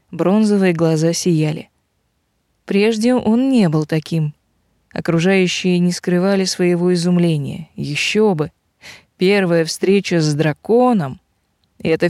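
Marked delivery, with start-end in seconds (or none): none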